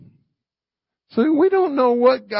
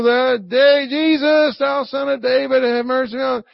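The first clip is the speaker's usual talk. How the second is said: higher-pitched pleading call; 4 semitones higher than usual. Retold or imitated. Blind man begging Jesus from the roadside, calling out. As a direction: call out like a beggar for mercy.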